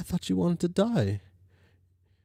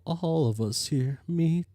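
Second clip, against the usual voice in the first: ordinary delivery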